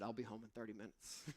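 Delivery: embarrassed low mumble; sheepish quiet reply. Retold or imitated. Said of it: "I just responded".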